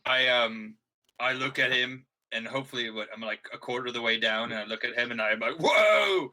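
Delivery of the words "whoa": loud, high "whoa" of admiration; long impressed holler; awed cheer. Acted. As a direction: whoop at a friend's perfect dive. Acts out cheering friend's dive.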